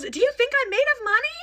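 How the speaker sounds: very high mock-tearful protest; about to cry. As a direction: imitate a mother wailing on the verge of tears. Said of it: "Like, about to cry".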